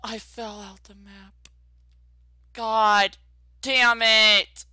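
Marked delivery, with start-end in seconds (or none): 2.5-4.6 very high, loud, slow exasperated shout; furious gamer after falling out of the map; angry yell. Real, not acted. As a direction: drag it out in outrage. Fell out of the map.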